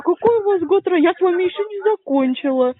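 sneering parody of the caller; mocking bleating imitation. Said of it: "непонятно чё блеешь, как овца". (передразнивает, блеет как овца)

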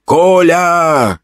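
a lightly voiced woman's call of "Коля!". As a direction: call him like a familiar woman. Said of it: "окрикнул меня знакомый женский голос".

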